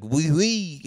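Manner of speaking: a cartoon Frenchman agreeing brightly; French guy. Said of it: "And he's like".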